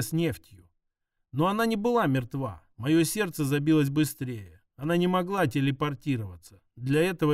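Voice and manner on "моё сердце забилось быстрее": plain reading voice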